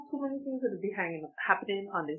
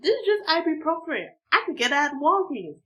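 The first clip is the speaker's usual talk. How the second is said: high, louder scoffing protest; dismissive complaint; 8 semitones higher, 7 dB louder than usual. Retold or imitated. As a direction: imitate an unimpressed buyer up high. Homegirl dismisses the pills, voiced.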